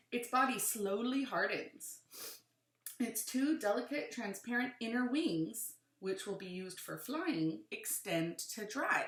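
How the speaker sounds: animated read-aloud with rising and falling emphasis; clear, lilting picture-book narration; reading to children. Acted. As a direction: read it to a class, punching key words.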